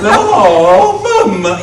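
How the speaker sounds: high, loud Italian plumber wail; Mario voice. Retold or imitated. While playing the game, a high loud Mario wail. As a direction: cry out like Mario losing a life. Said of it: "do the voices uncontrollably".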